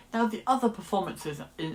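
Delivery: normal talking voice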